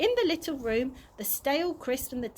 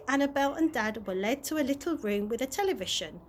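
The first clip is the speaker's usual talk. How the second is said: plain reading voice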